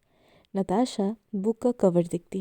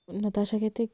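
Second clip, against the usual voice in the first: usual speaking voice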